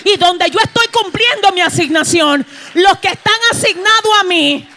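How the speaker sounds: the Baptist shouting his calling to the crowd; preaching shout as John. Acted. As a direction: proclaim it like John in the desert. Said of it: "dijo Juan".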